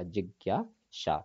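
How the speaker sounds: जिज्ञासा said slowly, each syllable spaced; slow careful word. Shown as a demonstration. Teacher to students, slow careful spelling of जिज्ञासा. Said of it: "ज्ञा ज्ञानी आएगा इनकी जगह पे".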